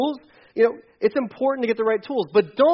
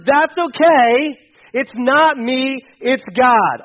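very slow, each word leaned on; slow emphatic demo; 4 semitones higher, 6 dB louder, much slower than usual. Shown as a demonstration. Preacher demonstrates the reply to the congregation, slow and emphatic. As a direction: model the response for the crowd to repeat. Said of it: "On three".

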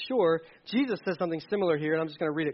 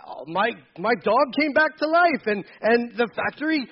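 higher, louder mock-cheery wishful voice; mock naive optimism; 5 semitones higher, 5 dB louder than usual. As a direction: play the gullible believer, hammed up. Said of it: "Like, no".

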